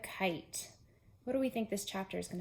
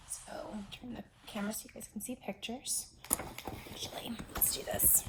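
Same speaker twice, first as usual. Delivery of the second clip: whisper; 9 dB quieter than usual. Real, not acted. Aside while adjusting camera.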